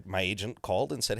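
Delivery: normal talking voice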